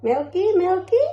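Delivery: high, loud, playful question; perky mommy voice. Real, not acted. Mother offers baby milk.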